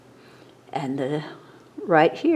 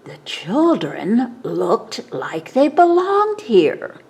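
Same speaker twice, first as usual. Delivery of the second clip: ordinary delivery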